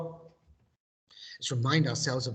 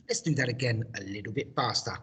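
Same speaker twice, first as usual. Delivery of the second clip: ordinary talk, nothing marked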